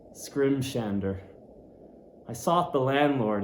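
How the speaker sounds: ordinary delivery, nothing marked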